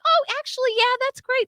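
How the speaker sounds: high-pitched, obliging imitation; agreeable company rep. Company rep granting installments to caller, agreeable imitation.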